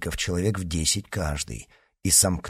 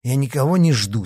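mildly impatient, raised pitch; losing patience; 5 semitones higher, 4 dB louder than usual. A narrator's reading. D'Artagnan denies waiting for anyone, mildly impatient. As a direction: let a touch of impatience in. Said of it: "начиная терять терпение".

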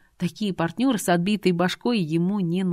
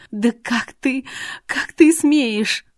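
high, louder gasp of outrage; indignant outburst; 7 semitones higher, 6 dB louder, much slower than usual. Acted. Irina jumps at Artur's insult, indignant and high. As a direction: draw out the outrage, pitched high. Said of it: "заставило Ирину буквально подпрыгнуть на месте".

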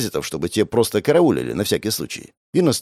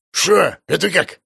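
put-on surprised old man; 9 semitones higher than usual.

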